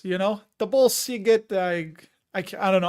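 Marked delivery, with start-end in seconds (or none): 0.6-1.5 Chicago accent, pitched up; half-successful Chicago accent; attempted Chicago accent. Shown as a demonstration. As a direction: have a go at a Chicago fan accent. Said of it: "I can't do it now".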